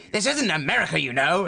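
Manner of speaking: mildly annoyed impression, starting high; mock irritation, lightly acted; Richard Attenborough. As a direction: play a touch exasperated. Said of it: "annoyed with him and keeps going".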